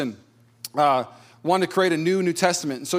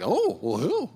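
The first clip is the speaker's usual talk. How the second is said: coy curiosity; 4 dB quieter, slower than usual. Retold or imitated. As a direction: play coy and flattered. Coyly asking who he resembles.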